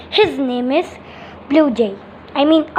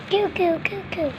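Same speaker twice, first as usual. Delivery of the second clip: put-on cooing baby; 3 semitones higher, 8 dB quieter than usual. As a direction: coo like a pretend newborn. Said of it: "just say goo goo gaga".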